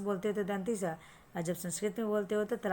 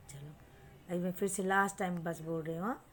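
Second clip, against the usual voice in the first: ordinary talk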